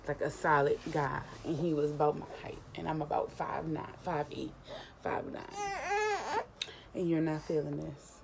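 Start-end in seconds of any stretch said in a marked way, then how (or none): 5.0-6.4 laughing, voice cracking high; squeaky laugh through the words. Real, not acted. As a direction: break into a giggle on it. Laughing correction of height.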